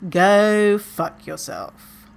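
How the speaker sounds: slow, deliberate brush-off; deadpan comeback. Shown as a demonstration. Suggested reply to a weight comment, slow and dry. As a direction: lay it down slowly and dry. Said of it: "the one you can use in".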